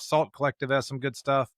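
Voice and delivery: ordinary delivery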